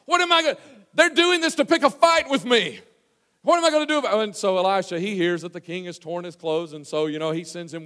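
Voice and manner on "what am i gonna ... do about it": high, loud theatrical indignation; acted-out distress at full voice; distraught outburst. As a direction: cry out like a panicked, offended king.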